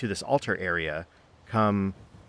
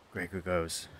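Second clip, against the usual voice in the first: ordinary talk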